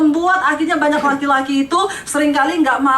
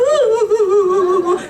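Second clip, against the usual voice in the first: high, drawn-out fake sobbing; mock wailing; 5 semitones higher than usual. Ignored wife cries harder while peeking at her husband, mock wail. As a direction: wail for attention, hammed-up. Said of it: "Yang nggak diperhatikan tambah baper".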